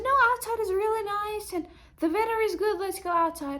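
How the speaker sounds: put-on high sing-song coaxing to a little boy; sweetly talking a child into going out; coaxing a toddler. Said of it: "And I said".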